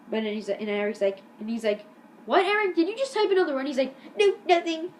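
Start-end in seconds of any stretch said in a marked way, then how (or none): 2.3-3.7 much higher, louder accusing question; mock stern teacher. Teacher catches Eric texting, imitated high accusing voice. 4.2-4.9 high, loud hasty-sounding denial; mock innocent denial. Eric caught texting by teacher, imitated high denial.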